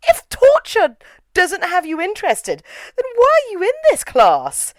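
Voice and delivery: high-pitched incredulous scolding; exasperated disbelief. Retold or imitated. Viewer telling off bored students in class, high exasperation. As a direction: demand an answer, voice climbing.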